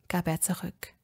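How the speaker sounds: ordinary delivery, nothing marked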